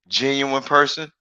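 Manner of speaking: ordinary talk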